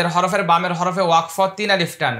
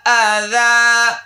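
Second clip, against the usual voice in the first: slow, drawn-out chanted recitation, higher than speech; Quran recitation; 5 semitones higher, 3 dB louder, much slower than usual. Acted. Teacher recites the verse for students, slow melodic chant. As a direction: intone each word slowly like a reciter.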